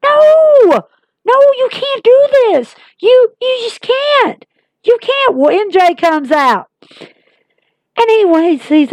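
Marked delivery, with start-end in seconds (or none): 0.0-5.4 much higher, crying no, you can't; a fan begging Jericho not to leave, high pitched protest; mock frantic protest. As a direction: plead in disbelief, high and strained. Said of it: "I'm like".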